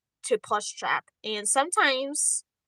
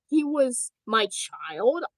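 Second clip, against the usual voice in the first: normal talking voice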